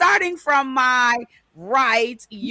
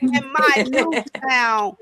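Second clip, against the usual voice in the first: usual speaking voice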